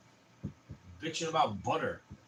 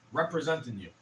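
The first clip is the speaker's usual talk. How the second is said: ordinary talk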